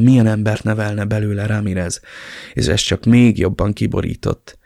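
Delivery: ordinary delivery, nothing marked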